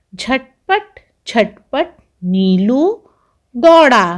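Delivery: slow sing-song rhyme for little ones; children's rhyme. Acted. Teacher reciting a pakora rhyme to pupils, slow sing-song. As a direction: chant it slowly like a nursery verse.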